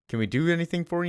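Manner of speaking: lightly voiced server asking a stranger; imitated diner staff. Voices wary diner folk addressing Jordan.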